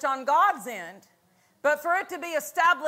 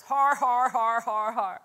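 forced "har har har", evenly punched and descending; Brother Hagin's laugh; 3 semitones lower than usual. Retold or imitated. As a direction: imitate a deliberate, mechanical belly laugh. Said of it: "if you ever heard Brother Hagin".

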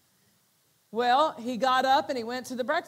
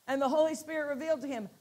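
usual speaking voice

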